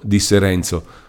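ordinary delivery, nothing marked

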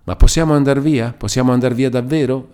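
high, anxious questioning; timid suspicion. A narrator's reading. Captive asking her rescuers whether they can leave, timid and suspicious. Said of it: "abbassando la voce e con uno sguardo timido e sospettoso".

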